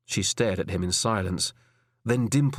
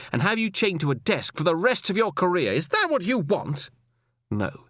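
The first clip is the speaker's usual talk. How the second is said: higher woman's voice, measured and firm; firm female superior; 7 semitones higher than usual. Acted. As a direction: lecture him with cool authority. Alison's final reprimand.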